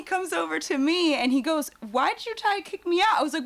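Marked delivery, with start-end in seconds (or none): 1.9-3.2 high, puzzled question; imitating Chris. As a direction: ask in a pitched-up bewildered voice. Chris confronting her.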